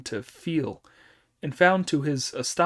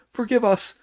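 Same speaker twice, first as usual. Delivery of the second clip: much higher, louder panicked cry; desperate shrill plea; 9 semitones higher, 6 dB louder than usual. Acted. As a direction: beg even harder, voice leaping up. Terrified officers plead.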